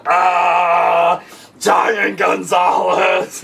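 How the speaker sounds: a hammed-up, drawn-out yell at the crowd; lightly hammed-up monster wrestler shout; mock wrestler yell. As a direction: bellow the name slowly like a big lumbering giant. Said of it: "He was like".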